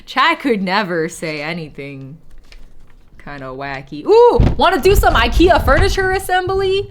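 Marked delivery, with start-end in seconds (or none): 4.0-6.9 high, loud eager invitation; excited. Real, not acted. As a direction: burst out with real excitement. Excited proposal to the chat.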